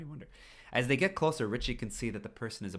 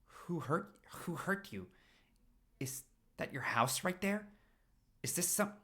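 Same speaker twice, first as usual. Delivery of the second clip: panicky; 8 semitones higher, 3 dB quieter than usual. Acted. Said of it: "Richie, calm down".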